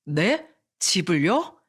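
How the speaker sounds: taken-aback questioning with rising endings; mildly thrown by the question; flustered. Acted. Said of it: "예비 장모의 질문에 당황스러웠어요". (살짝 당황해서 되묻는 말투)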